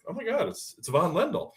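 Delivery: high-pitched, stammered retold recognition; starstruck. Franchisee spotting famous player at window, starstruck.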